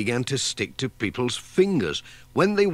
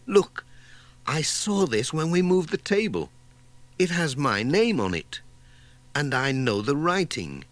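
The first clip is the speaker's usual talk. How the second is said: higher, eager village shopkeeper voice; excited discovery; 5 semitones higher than usual. Acted. Missus Pottage finds her own letter, higher excited voice. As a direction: spot it and point, thrilled.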